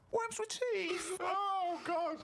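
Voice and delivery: very high, very slow mocking announcement; mock dramatic reveal. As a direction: drag out 'Worms with teeth' in a high teasing voice. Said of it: "I'll get her attention at some point".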